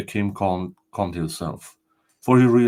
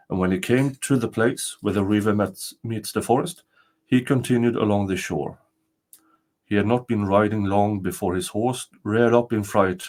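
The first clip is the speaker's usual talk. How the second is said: plain reading voice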